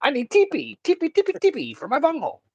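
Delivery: high, manic cartoon babble; shrieky-pitched Cornholio rant; Beavis. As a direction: pitch it way up and jabber like a crazed cartoon teen. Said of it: "I'm like Beavis running around".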